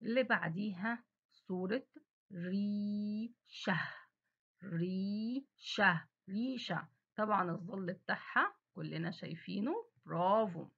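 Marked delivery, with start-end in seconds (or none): 2.3-3.8 ريشة stretched very slowly. Shown as a demonstration. Teacher to children, stretching ريشة slowly. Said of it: "أنا نطقت إيه بقى". 4.6-6.1 ريشة stretched very slowly. Shown as a demonstration. Teacher to children, stretching ريشة slowly. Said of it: "أنا نطقت إيه بقى".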